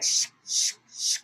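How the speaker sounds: unvoiced shh, shh; whispered shushing. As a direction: hush them with a whisper. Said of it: "She's like".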